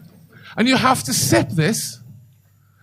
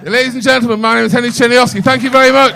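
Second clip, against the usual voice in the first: loud sign-off; 4 semitones higher, 8 dB louder, faster than usual. Real, not acted. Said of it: "sign-off to the audience".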